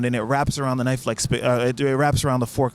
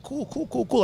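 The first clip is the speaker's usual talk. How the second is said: high, soft rattle of repeats; retold quick acknowledgment; 9 semitones higher, 4 dB quieter than usual. Replying to the Dutch pair's explanation, high soft acknowledgment. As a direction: toss it off softly in a high voice.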